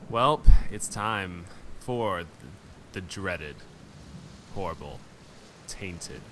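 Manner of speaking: slow, ominous build-up; dramatic announcer. Acted. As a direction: drag out each dreadful word. Dramatic segment introduction.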